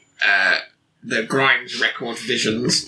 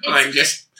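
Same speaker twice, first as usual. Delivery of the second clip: ordinary talk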